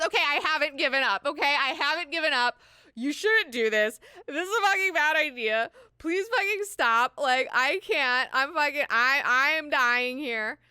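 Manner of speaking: loud, high, hammed-up outburst; frantic pleading. Irulan begs Ghanima to stop, frantic pleading. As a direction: beg at full volume, at the end of your rope.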